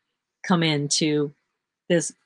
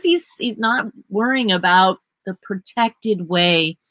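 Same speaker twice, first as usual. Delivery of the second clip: ordinary talk, nothing marked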